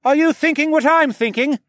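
high, loud excited question; shouted question. A narrator's reading. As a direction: call it out over the battle. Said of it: "he asked".

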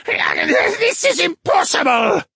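high, slow, loud monster scream; distorted wraith voice. A narrator's reading. Trapped wraith cannot escape, distorted scream. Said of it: "The wraith's voice was distorted".

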